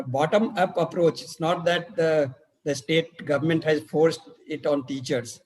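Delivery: usual speaking voice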